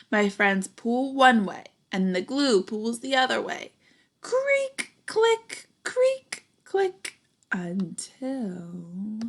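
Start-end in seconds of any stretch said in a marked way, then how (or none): 4.2-7.2 high squeaky creak and click sounds; creaky clicks. A narrator's reading. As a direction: squeak it like straining plastic. Sound effects of tow truck.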